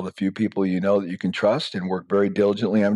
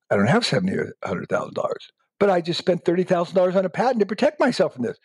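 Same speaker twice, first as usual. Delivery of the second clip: fast, rising to a higher pitch; exasperated protest; 9 semitones higher, faster than usual. Pretend. Inventor protesting to the lawyer, fast and dismayed. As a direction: blurt it out in dismay.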